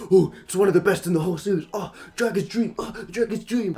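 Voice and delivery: high, gushing mock fan voice, fast at first; mocking fanboy. Mocking online fans who defend the fight, gushing. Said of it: "some fucking monkeys online defend this fight and be like".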